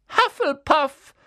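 loud, high, slow hat's cry; calling out. A narrator's reading. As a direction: proclaim the house to the hall. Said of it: "rief der Hut abermals". (laut verkündet vom Hut)

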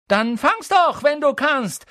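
high, loud dare; shrieked schoolboy taunt; shouting. A narrator's reading. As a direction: yell a challenge at them. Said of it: "schrie er".

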